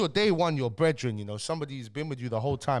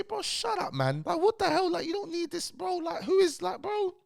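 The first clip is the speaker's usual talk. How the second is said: much higher, fed-up brush-off; exasperated telling off. Pretend. Imagined Bambino telling the manager to shut up, exasperated. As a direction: play him snapping back, fed up. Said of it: "if I was Bambino, I'd say".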